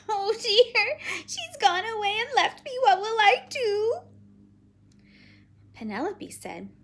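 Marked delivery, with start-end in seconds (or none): 0.1-4.0 high, loud wail of dismay; distressed little girl. Acted. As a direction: wail like a child left alone. Child thinks she is abandoned.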